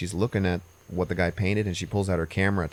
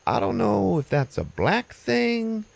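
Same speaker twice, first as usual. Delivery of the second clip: much higher, slow mock-pained Patty; hurt Patty; 14 semitones higher, slower than usual. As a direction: drag it out, sore and fed up, pitched way up. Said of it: "she's hurting, and she's like".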